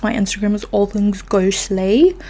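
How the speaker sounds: put-on a light British accent creeping in; slipped British accent. Podcaster plugging her Instagram in a playful British accent. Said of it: "There we go again with the accents".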